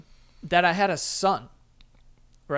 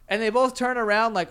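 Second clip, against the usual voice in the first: ordinary talk, nothing marked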